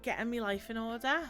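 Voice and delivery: ordinary talk, nothing marked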